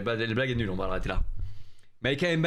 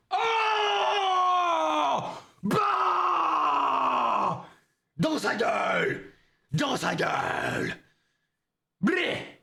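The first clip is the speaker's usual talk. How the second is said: hype shout; 11 semitones higher, much slower than usual. Real, not acted. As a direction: roar it as the object breaks. Smashing the USB key. (hurlement de victoire en cassant la clé)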